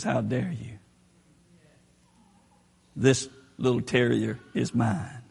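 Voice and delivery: low, soft "how dare you"; quiet rebuke. Retold or imitated. The Lion claims the terrier, a low, hushed rebuke. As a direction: rebuke the enemy softly, low and firm. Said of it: "and says".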